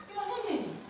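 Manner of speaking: Helen with a high, corrected pitch. Shown as a demonstration. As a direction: say Helen lifted high and clearly. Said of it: "Don't say Helen".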